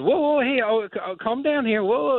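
high-pitched flustered whoas; mock alarm. Imitating Carl Edwards rattled by mind games, high mock alarm. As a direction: imitate someone trying to settle things down, voice way up.

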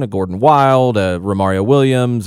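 usual speaking voice

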